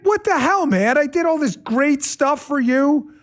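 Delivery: high-pitched exasperated wife voice; imitating angry wife. Host acts out his wife scolding him, high and loud. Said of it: "she's like".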